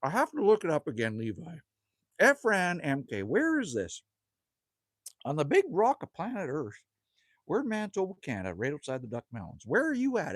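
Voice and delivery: normal talking voice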